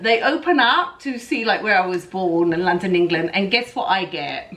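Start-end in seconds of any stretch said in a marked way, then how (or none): none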